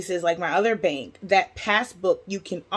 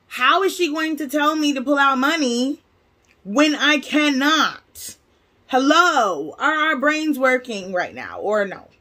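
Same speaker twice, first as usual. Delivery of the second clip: higher, indignant disbelief at the staff; exasperated retold rant; 6 semitones higher than usual. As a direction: replay the baffled outrage, pitched up. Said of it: "I know that I'm upset".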